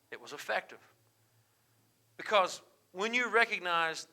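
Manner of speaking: ordinary talk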